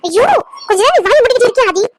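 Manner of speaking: fed-up plea for silence; exasperated. Acted. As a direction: tell him off, pitch jumping up. Exasperated woman tells Aadhi to shut up.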